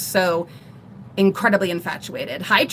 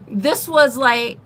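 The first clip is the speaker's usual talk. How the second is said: ordinary talk, nothing marked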